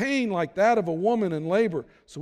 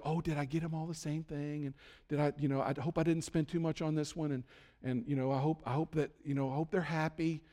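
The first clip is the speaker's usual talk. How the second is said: worried mom; 2 semitones lower, 8 dB quieter than usual. Retold or imitated. Mom's worried thoughts at Christmas.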